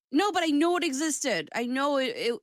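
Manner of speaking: normal talking voice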